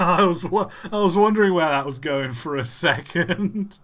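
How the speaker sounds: high, loud words through real laughter; laughing while talking. Real, not acted. Streamer reacting to an absurd train narration, laughing hard. Cracking up at parody clip.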